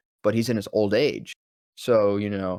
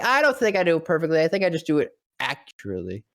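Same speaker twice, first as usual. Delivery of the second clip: quick modest reply; 5 semitones higher, faster than usual. Retold or imitated. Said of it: "I'm like".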